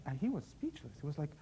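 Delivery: ordinary delivery, nothing marked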